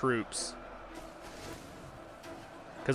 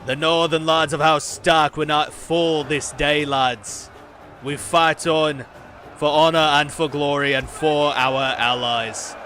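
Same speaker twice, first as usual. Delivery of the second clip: loud northern lord rallying his men; Jon Snow impersonation; 3 semitones higher, 5 dB louder than usual. Acted. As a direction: give a rousing battle speech in a northern accent. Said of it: "my best Jon Snow impersonation".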